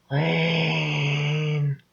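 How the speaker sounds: high, soft, eerie drawn-out call; sinister ghoul voice. A narrator's reading. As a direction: call from the dark corners like a demon. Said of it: "a low, sinister, subhuman growl".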